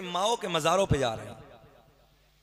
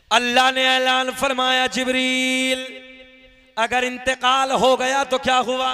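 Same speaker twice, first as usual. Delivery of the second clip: loud, high proclamation; fervent sermon proclamation; full-force preaching; 8 semitones higher, 11 dB louder than usual. Acted. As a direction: thunder it out to the gathering. Preacher proclaims God's words.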